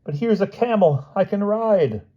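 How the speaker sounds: very high, put-on storybook voice; high cry of a hapless character; high-voiced Hyde. A narrator's reading. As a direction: pitch it way up, far from the narrating voice. Frightened Hyde spots a camel.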